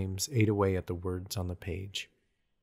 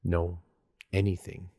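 ordinary delivery

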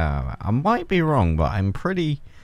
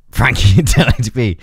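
sudden louder goofy delivery; silly put-on voice; 6 dB louder than usual. Gamer joking about himself, silly put-on voice. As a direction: slip briefly into a daft voice. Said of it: "started talking like that".

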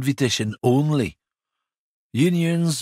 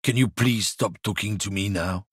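ordinary talk

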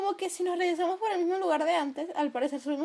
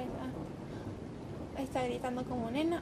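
normal talking voice

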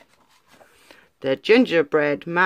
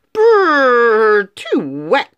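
squeaking; 4 semitones higher, 5 dB louder, much slower than usual. Acted. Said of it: "squeaked the gingerbread man".

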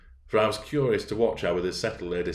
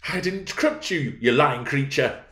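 high, drawn-out angry denial; heated, high-pitched accusation; indignant cry; 7 semitones higher, 3 dB louder than usual. Acted. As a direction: cry it out, red with rage. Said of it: "cried she".

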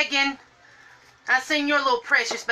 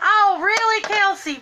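surprised; 10 semitones higher, 4 dB louder than usual. Real, not acted. Reacting to viewer news.

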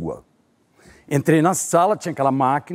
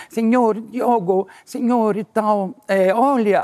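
slow, higher Portunhol voice; lightly voiced Spanish-accented clerk, drawn out; 6 semitones higher, much slower than usual. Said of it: "ela falava aquele espanhol, portunhol".

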